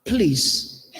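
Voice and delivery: ordinary talk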